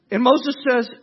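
ordinary delivery

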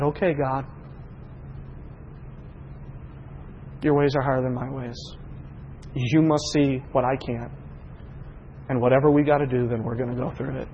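low, soft, halting prayer; hushed, resigned acceptance; surrendered prayer. Retold or imitated. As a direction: yield to God quietly, pausing between phrases. Retold prayer of surrender, repeated.

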